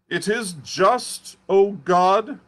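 high, slow, trembling-edged preacher's lament; the unhinged curate crying out to God, drawn out and high; frantic curate. Acted.

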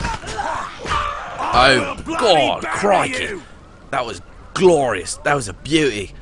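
hammed-up Aussie exclaiming, then relishing it; Australian accent. Player gloating to his mate over a zombie kill, Australian accent. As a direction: crow over the hit in a put-on Australian accent. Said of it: "I'm mixing English and Australian".

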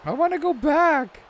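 put-on high, squeaky little girl pleading; little daughter's voice. Dad voicing his daughter begging to go back, high and squeaky. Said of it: "and then they said".

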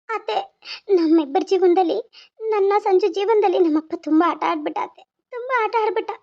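tearful complaint to her aunt; crying. Acted. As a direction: let sobs break up the phrases. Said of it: "ಯಾಕೆ ಅಳಕತ್ತೀಯ". (ಬಿಕ್ಕುತ್ತಾ ದೂರು ಹೇಳುವುದು)

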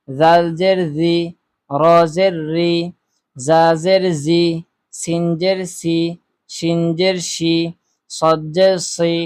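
steady, measured drill voice, each syllable called out; recitation. Shown as a demonstration. Teacher leading viewers through the zabar drill, measured recitation.

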